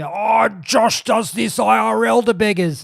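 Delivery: high, loud mock-outraged viewer voice. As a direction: squeal it like a scandalised fan. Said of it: "you'd be".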